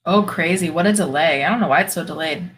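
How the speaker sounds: normal talking voice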